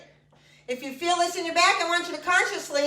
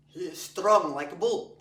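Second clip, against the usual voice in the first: lightly put-on Eastern European accent, lower; a hint of a bad Eastern European strongman accent; terrible Eastern European accent; 9 semitones lower than usual. Fitness teacher jokes to class about a strong man, mock Eastern European accent. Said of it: "That terrible accent".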